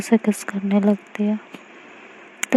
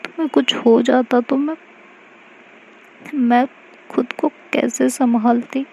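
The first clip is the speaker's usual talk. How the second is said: crying while talking; 4 semitones higher than usual. Acted. Mahi to hurt Aashi, tearful reproach. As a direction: scold her through tears, mildly. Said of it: "रोने लगती है और कहती है".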